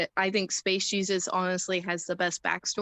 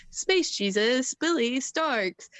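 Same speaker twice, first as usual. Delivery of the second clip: higher, drawn-out imitation of a ring introduction; ring announcer; 5 semitones higher, slower than usual. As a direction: stretch out each name like an announcer. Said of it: "the locker room who's the announcer".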